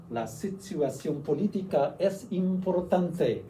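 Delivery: slow, careful Spanish accent; measured Spanish pronunciation. Shown as a demonstration. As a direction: read it out slowly in clear Spanish. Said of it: "That is nice Spanish pronunciation".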